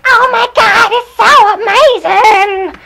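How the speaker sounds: very high, very loud gushing scream; mock fan shriek. Fans seeing their obsession come up, mock shriek.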